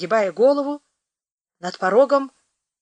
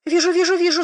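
high, hurried agreeing; quick anxious yeses; eager nodding; 10 semitones higher, 3 dB louder than usual. Acted. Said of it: "согласно замотал головой".

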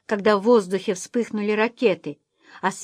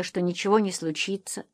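plain reading voice

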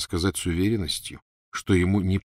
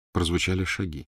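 ordinary delivery, nothing marked

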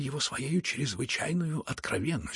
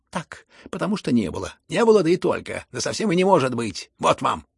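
exasperated shouting; 8 dB louder than usual. A narrator's reading. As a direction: shout him down, fed up. Exasperated son shouts his father down.

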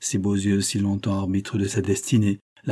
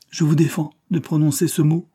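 higher, urgent command; forbidding; 7 semitones higher, 4 dB louder than usual. A narrator's reading. Madeleine stopping Prosper's talk of suicide, forbidding. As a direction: forbid it firmly.

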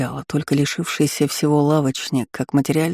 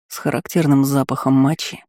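usual speaking voice

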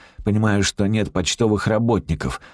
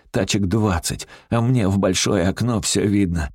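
ordinary delivery, nothing marked

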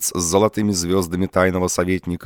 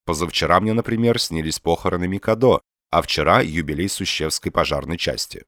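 ordinary delivery

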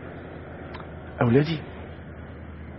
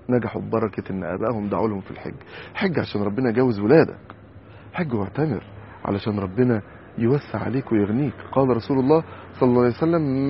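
ordinary delivery

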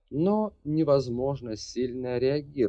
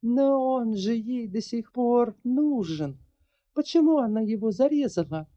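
high, quick baffled question; bewildered exclaim; 3 dB louder than usual. Acted. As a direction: blurt it out in confusion. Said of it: "непонимающе воскликнула Ларри".

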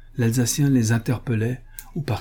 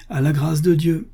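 higher-pitched rallying exclamation; exclaiming; 6 semitones higher, 3 dB louder than usual. A narrator's reading. Paul at the wheel setting off, exclaiming. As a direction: call it out as you set off. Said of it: "s'exclama Paul".

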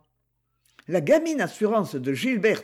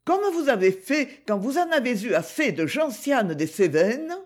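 put-on higher, lively girl's voice; higher, playful young girl; Gigi's voice; 3 semitones higher than usual. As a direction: play the teasing young girl greeting her uncle. Gigi voiced in read story.

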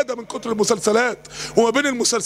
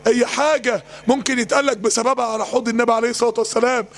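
ordinary talk, nothing marked

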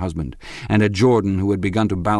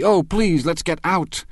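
pleading cry; 11 semitones higher than usual. A narrator's reading. Wife begging to leave the room, high and pleading. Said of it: "cried Daisy".

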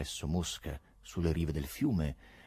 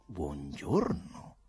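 slow, low, drawn-out hello with a lift at the end; measured, courteous doctor's hello; smooth doctorly greeting; 4 semitones lower, 4 dB quieter, much slower than usual. A narrator's reading. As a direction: greet the patient slowly and gently.

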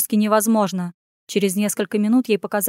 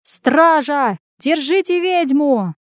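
high, loud, drawn-out shout; furious roar; 5 semitones higher, 4 dB louder, much slower than usual. A narrator's reading. Waiter calls guards on fleeing Anya, roaring furiously. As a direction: bellow after the runaway for the guards. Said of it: "Ей вслед нёсся яростный рык".

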